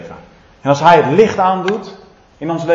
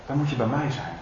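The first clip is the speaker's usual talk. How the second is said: quiet echo; 10 dB quieter than usual. Shown as a demonstration. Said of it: "repeats softly after shout".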